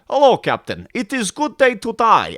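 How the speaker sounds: loud, high comic Russian Klingon; mock Russian accent. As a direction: belt it out in a fake Russian accent. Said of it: "Worf never sounded like".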